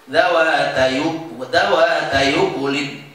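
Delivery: "dawatai ukulin" as dawata-yu-kulin, recited evenly; joined recitation. Shown as a demonstration. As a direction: glide "dawatai" into "ukulin" without a stop. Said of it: "Gimana bacanya?".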